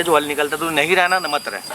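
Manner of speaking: ordinary talk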